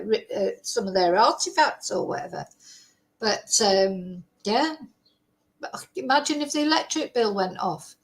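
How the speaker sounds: normal talking voice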